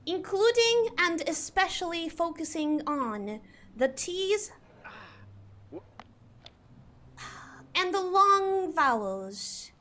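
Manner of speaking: deliberate BBC English with every letter pronounced; posh British accent. Shown as a demonstration. Streamer explaining tenets of British English, posh British. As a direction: enunciate carefully in proper British English. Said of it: "proper pronunciation of every single".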